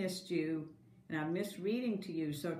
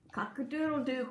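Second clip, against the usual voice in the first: higher crowing cock-a-doodle-doo; rooster crow; 6 semitones higher than usual. Acted. Teacher voicing the rooster for kindergarten, crowing. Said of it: "crowed the rooster".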